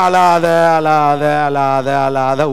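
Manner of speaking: low, slow, drawn-out comforting; soothing townsfolk. Retold or imitated. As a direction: gently hush a grieving mother. Townsfolk comforting grieving widow.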